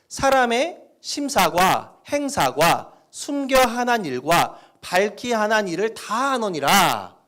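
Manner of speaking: slow, loud North Korean dialect reading. Shown as a demonstration. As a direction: recite slowly in a northern dialect. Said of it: "북한 사투리를 쓴다고 생각하고 읽으시면".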